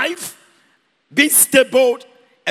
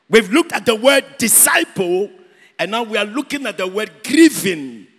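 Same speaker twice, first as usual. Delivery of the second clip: normal talking voice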